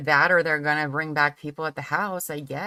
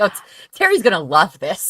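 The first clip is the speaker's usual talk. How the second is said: excited; 4 semitones higher, 10 dB louder than usual. Retold or imitated. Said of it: "I was like".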